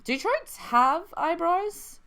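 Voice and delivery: troits said with a high, slow Boston oi, well above his own voice; hammed-up Boston fish asking about troits; Boston trout accent. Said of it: "we say".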